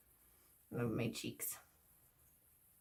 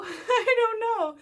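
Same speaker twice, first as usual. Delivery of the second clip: high, loud, drawn-out laughing words; helpless giggly outburst; 13 semitones higher, 8 dB louder, much slower than usual. Real, not acted. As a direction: laugh your way through it, pitch shooting up. Laughing at her own breathlessness.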